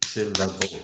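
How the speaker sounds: Syllable said beat by beat; Syllable, three clear beats; syllables spelt out. Shown as a demonstration. Host echoing the teacher, Syllable in three beats. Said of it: "so syllable".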